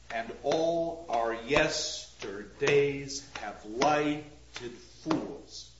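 "And all our yesterdays have lighted fools" slowly scanned beat by beat, low and even; regular metre. Shown as a demonstration. Lecturer tests the line for regular metre, slowly scanned. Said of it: "We're pretty close to being regular here".

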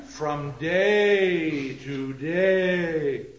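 very slow, loud, long "day" each time; drawn-out sound. Shown as a demonstration. Lecturer showing sound creating the meaning, drawn-out sound. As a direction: stretch out both "day"s. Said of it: "the sound is creating the meaning".

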